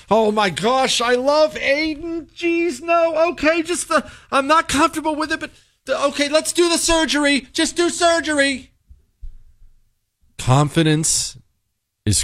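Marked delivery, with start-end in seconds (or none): 0.1-8.7 very high, anxious mock whimper; hand-wringing parent. Parent giving in to surgery, hand-wringing high mock voice. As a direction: dither and cave in a high, feeble voice. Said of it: "we have parents who hand wring".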